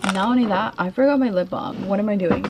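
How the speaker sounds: ordinary talk, nothing marked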